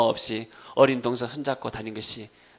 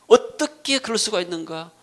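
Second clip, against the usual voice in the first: raised, firm protest; indignant disbelief; 6 semitones higher, 3 dB louder than usual. Acted. Preacher voices an offended church member, indignant and raised. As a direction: question it as an affront. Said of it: "하게 되면은 내가".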